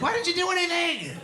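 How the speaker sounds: high whiny accusation; mock husband accusing. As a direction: whine the complaint up high.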